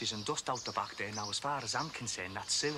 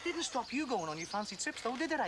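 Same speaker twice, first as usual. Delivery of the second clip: raised, higher-pitched furious taunting; bitter accusation; 10 semitones higher than usual. Acted. Husband accusing his friend of fathering his son, furious and pitched high. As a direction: jab every line at him with scorn.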